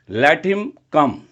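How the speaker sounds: ordinary delivery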